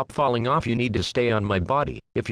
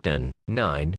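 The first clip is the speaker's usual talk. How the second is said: launch countdown; 7 semitones lower than usual. Acted. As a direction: count down like mission control. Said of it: "it sounds like".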